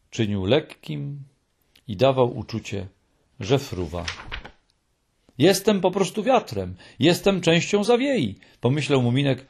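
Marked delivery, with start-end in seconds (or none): none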